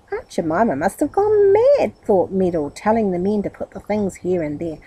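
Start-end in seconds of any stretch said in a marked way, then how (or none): 0.1-1.9 high, startled exclamation; astonished. A narrator's reading. Meddle staring at all the furniture, astonished. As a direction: gasp it out in disbelief.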